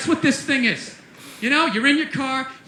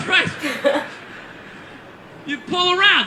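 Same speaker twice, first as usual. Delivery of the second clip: normal talking voice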